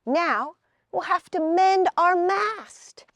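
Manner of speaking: a put-out little hero, voiced high; Woody's voice. A narrator's reading. Woody character voice.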